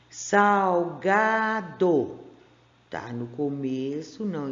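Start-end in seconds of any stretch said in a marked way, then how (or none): 0.1-2.2 each syllable stretched; slow, drawn out. Shown as a demonstration. Teacher models the word for children, very slowly. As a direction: read it out slowly for the class. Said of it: "não está entre vogais".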